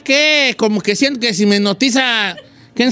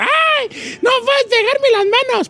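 much higher, panicked mock shriek; boss screaming; 14 semitones higher than usual. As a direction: squeal like the boss stuck to her hands. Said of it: "estaba grite y grite por toda la casa".

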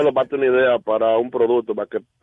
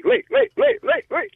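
very high, squeaky repeated calling; shrill mock alarm-clock voice; piercing nagging voice; slower than usual. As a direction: squeal the name over and over. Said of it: "con la voz de tigre".

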